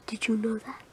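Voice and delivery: unvoiced whisper. Real, not acted. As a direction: whisper it like a secret. Secret aside to viewers.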